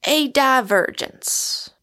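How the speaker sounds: high, slow, drawn-out pronouncement; genteel, relieved announcement; refined relief. A narrator's reading. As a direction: land on the sought word with relief. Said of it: "with a refined sigh of relief".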